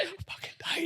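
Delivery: breathless from holding it underwater, laughing; laughing gasp. Retold or imitated. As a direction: squeal it through a laugh. Out of breath in pool.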